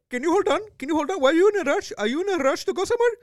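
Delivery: high, loud mock Indian accent; imitating an exasperated Indian-accented homeowner; heavy Indian accent. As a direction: question him in an exaggerated Indian accent. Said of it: "he had a mega seven eleven accent".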